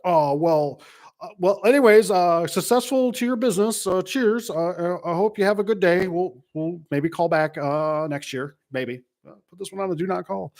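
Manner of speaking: hammed-up caller fumbling for an exit; flustered caller. Salesman offered a job on the phone, high flustered retreat. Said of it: "and they're like".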